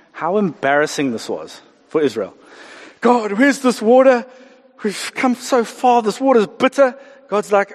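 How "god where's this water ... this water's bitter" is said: high, dragged-out mock complaint; grumbling moan. Israelites grumble to God about bitter water, mock moaning. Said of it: "As we grumble and moan".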